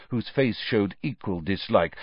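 usual speaking voice

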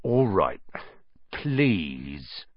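grudging. A narrator's reading. Caretaker grudgingly gives in.

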